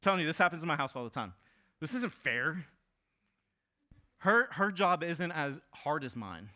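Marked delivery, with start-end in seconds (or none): 1.8-6.5 put-on whiny kid. Kids complaining in preacher's house.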